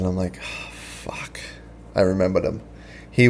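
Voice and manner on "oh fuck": breathless whispered oh fuck; whispered curse. Retold or imitated. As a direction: whisper it under your breath, dismayed. Retold moment of dread.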